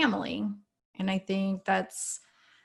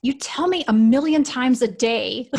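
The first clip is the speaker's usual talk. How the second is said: put-on higher, louder, slow, fed-up child; mock annoyed kid; 4 semitones higher, 7 dB louder than usual. Mom retelling her son's irritated reply, mock annoyed. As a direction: play the son's grumpy protest. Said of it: "he was angry about it".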